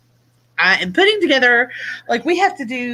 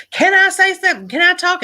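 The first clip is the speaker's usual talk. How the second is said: higher, louder imitation of the demanding customer; mock pushy customer; 10 semitones higher, 3 dB louder than usual. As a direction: push in, begging to be heard. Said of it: "she said".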